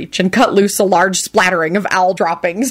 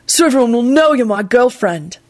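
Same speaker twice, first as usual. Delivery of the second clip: raised-pitch, resolute declaration; earnest resolve, pitched up; determined; 6 semitones higher than usual. A narrator's reading. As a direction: carry on the determined promise. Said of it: "he pronounced determinedly".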